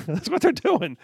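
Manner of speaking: amused laugh while talking. Real, not acted. Laughing at own joke.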